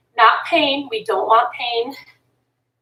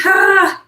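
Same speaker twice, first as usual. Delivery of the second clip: effort sigh; 7 semitones higher, 6 dB louder than usual. Real, not acted. Strained effort mid-exercise.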